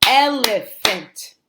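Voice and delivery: "elephant" slowly as el-e-phant, first beat strongest; syllable by syllable. Shown as a demonstration. Teacher modelling a new word, "elephant" slowly in syllables. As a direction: stretch "elephant" into three clear beats. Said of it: "break it down by syllable".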